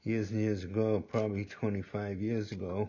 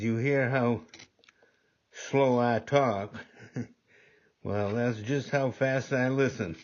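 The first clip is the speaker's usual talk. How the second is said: higher, louder, slow Southern drawl; imitated Southern drawl; 4 semitones higher, 4 dB louder, slower than usual. Older Southern man teasing a fast-talking young lady, slow Southern drawl. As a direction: savour each word in a lazy Southern drawl. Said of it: "he had a real strong Southern drawl".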